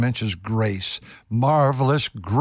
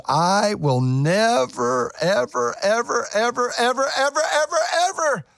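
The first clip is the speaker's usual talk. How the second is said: each ever climbing higher; building repetition; 9 semitones higher than usual. Acted. Preacher quoting God's promise, each "ever" climbing higher. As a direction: pile up "ever" higher and higher until it peaks.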